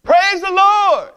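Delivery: high, loud joyful yell of praise. Retold or imitated. Old lady yelling praise from her porch, joyful shout. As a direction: call it out from the porch, high and bright. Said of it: "would yell out".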